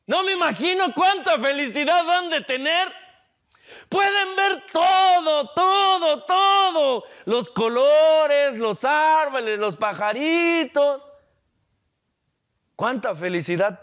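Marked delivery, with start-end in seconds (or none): 0.1-2.9 put-on child voice. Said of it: "y el niño diría". 3.9-11.1 passionate shout. Real, not acted. Passionate build on seeing everything.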